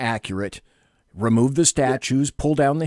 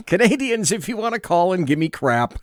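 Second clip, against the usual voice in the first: laughing; 6 semitones higher than usual. Real, not acted. Teasing callers.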